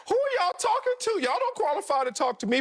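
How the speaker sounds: high-pitched indignant comeback, easing down; Lamar Jackson. Acted. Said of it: "if I were Lamar Jackson".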